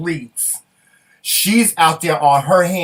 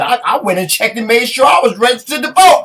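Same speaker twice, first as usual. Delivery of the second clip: high, loud, worked-up retelling; comic alarm; 8 semitones higher, 7 dB louder than usual. Retold or imitated. Co-host retelling her scare over voter registration, comic alarm. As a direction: climb higher and louder to "vote".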